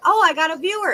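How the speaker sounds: mock thrill at spotting a viewer; excited quote. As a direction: streamer retelling her glee at a new viewer, high and bright. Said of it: "and I'm like".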